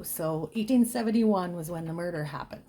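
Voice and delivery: normal talking voice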